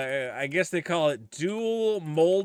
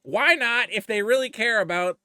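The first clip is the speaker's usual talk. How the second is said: mock reasoning; 6 semitones higher, 3 dB louder than usual. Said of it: "part of me goes".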